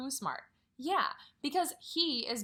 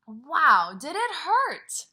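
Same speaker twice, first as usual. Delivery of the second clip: loud, high and drawn-out wow; mock surprise; 5 semitones higher, 14 dB louder, much slower than usual. Teacher reacting for her class to the bee sting, high mock surprise. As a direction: gasp it out for the kids like it hurts.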